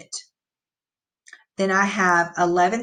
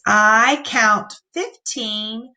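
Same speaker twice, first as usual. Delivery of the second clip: put-on slow, word-by-word reading for children; slow modeled reading; slow read-aloud; much slower than usual. Shown as a demonstration. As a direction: read it out slowly, one word at a time. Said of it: "Let's read that sentence".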